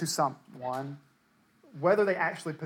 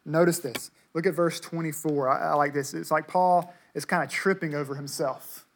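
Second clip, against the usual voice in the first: ordinary delivery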